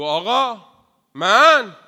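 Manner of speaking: loud, high cry of volunteering; eager loud call. Acted. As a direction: shout out to offer yourself. (فریاد مشتاق و بلند)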